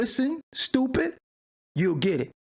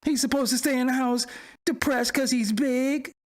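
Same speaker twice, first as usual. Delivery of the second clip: higher-pitched mimicry of a commenter; wimpy mocking voice; 5 semitones higher than usual. Host repeats the commenter's excuse, wimpy mocking voice. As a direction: throw the excuse back in a raised, mocking voice. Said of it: "like a bitch ass voice".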